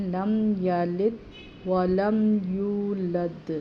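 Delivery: لم یلد ولم یولد joined slowly with no breath between; no pause. Shown as a demonstration. Teacher demonstrating no stop mid-verse, slow and joined. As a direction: say it all in one breath, slowly. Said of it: "بیچ میں سانس نہیں لینا".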